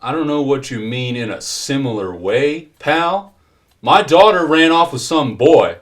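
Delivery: loud, high angry retort; irate father. Acted. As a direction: throw it back at him angrily. Irate father snaps back at agent.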